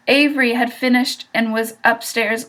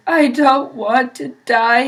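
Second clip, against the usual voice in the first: moaned; 3 semitones higher, 5 dB louder than usual. A narrator's reading. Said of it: "he moaned".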